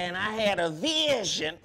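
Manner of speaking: slow, loud, dramatic promo line; wrestling promo. Acted. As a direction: build it up like a wrestler. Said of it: "it's a promo".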